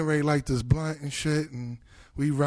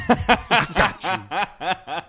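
laughing; 2 semitones higher, much slower than usual. Retold or imitated. [name] offering a ride.

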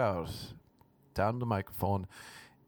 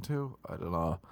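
usual speaking voice

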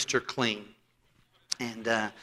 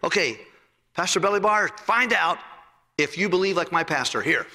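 excited Indian friend; 6 semitones higher than usual. Retold or imitated. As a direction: push it up and out like the insistent friend. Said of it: "He says".

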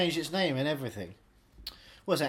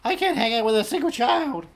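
mock rant; 9 semitones higher, 8 dB louder than usual. Continues loud mock rant.

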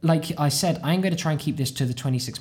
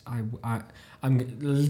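usual speaking voice

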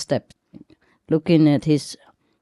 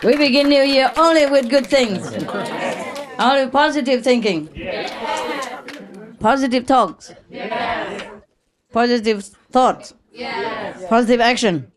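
upbeat exhortation; 5 semitones higher than usual. Real, not acted. Upbeat New Year exhortation.

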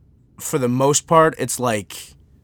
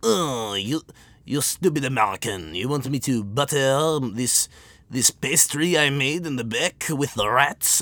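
exaggerated French-accented disdain; mock French accent. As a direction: play a snooty Frenchman with a thick accent. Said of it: "when you look at me and be like".

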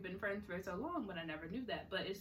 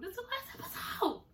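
excited emphasis; 12 semitones higher than usual. Shown as a demonstration. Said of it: "repeats higher with laugh".

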